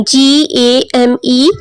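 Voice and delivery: "जी A M E" letter by letter, each distinct; teacher spelling "जी A M E" for the class; spelled out. Shown as a demonstration. Teacher spelling out the guessed word.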